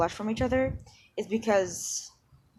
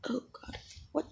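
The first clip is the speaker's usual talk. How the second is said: ordinary delivery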